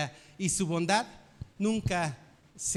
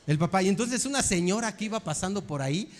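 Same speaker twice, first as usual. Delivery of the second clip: usual speaking voice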